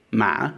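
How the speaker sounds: "ma" dipping, then rising like a question. Shown as a demonstration. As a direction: ask "ma" with the tune of a doubtful "really". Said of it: "questioning tone".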